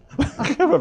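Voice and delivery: a short, high burst of laughter; real laugh. Real, not acted. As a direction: laugh out loud at the joke. Host laughs at sidebar chat.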